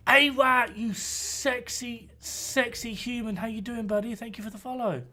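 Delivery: cooing. Acted. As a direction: coo at them like a pet. Coos at new follower.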